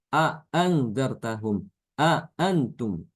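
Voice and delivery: A, antum slow with two throat stops; two hamzas separately. Shown as a demonstration. Teacher showing the harder two-hamza tahqiq reading. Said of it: "dua kali mengucapkan hamzah".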